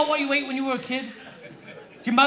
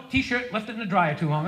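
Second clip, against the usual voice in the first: ordinary talk, nothing marked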